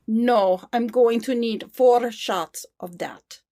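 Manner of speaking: deliberate, slowed Russian accent. Shown as a demonstration. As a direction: order coffee in a Russian accent. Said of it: "used to be able to do a Russian one".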